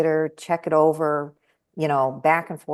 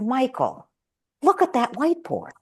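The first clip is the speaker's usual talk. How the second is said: much higher, emphatic exasperation; pointed retort; 8 semitones higher than usual. Retold or imitated. To husband Michael, lost in his notes: high exasperated point. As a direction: make him see what's right in front of him. Telling husband to look at board.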